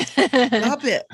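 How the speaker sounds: high, firm stop it; firm command. Real, not acted. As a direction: scold firmly but playfully. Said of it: "one of the few directives".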